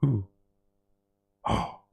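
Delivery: normal talking voice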